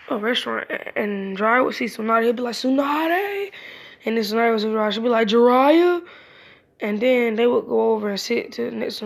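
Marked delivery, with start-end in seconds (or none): 2.6-3.5 calling out. Retold or imitated. Jiraiya spots Tsunade, calls out. 5.3-6.0 calling out. Retold or imitated. Tsunade spots Jiraiya, calls out.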